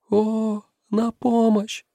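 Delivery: very high put-on girl's voice; girl crying for help. A narrator's reading. Unseen girl calls to the men in the cave, high-pitched cry. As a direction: plead in a thin high voice. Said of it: "кричал голос".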